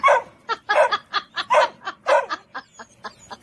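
loud, high burst of real laughter. Real, not acted. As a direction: crack up at the video. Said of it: "I shouldn't be laughing".

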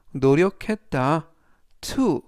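ordinary talk, nothing marked

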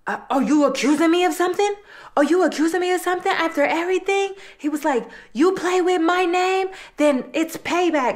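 hammed-up threatening boyfriend; mock accusing.

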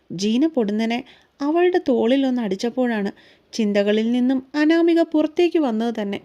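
plain reading voice

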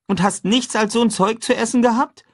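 put-on high, lively boyish voice; a cheerful boy proposing breakfast; boy talking brightly to his friend; young boy Huck. A narrator's reading. Huck's voice.

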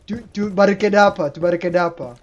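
ordinary talk

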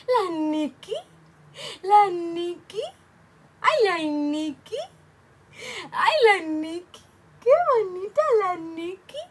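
high, loud sing-song cooing; imitating niece's cooing. Imitating the niece cooing at the baby, high sing-song. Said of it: "that's how she went".

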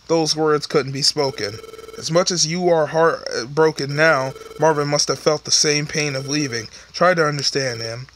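story narration. Acted. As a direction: read it like a somber storyteller. Reads game narration lines.

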